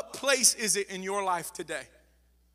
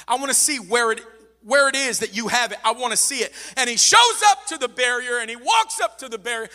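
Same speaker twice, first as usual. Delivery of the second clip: building to a loud, raised peak; preaching at full force; 2 semitones higher, 7 dB louder than usual. Real, not acted. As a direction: press harder with each repetition.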